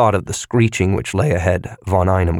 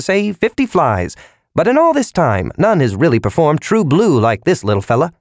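cheerful salesman voice; 7 semitones higher than usual. A narrator's reading. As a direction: gush like a cheesy salesman. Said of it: "tinny mechanical voice ... cheerfully".

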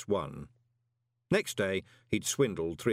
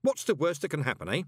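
higher-pitched attempt at cheer; forced joviality; 7 semitones higher, 3 dB louder than usual. A narrator's reading. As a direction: act breezy about the danger. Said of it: "trying to sound jovial".

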